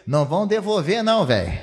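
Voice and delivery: loud, high mock-boy retort; acting out cheeky defiance; defiant refusal. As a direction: throw the refusal back at the old man. Boys refuse the pleading father.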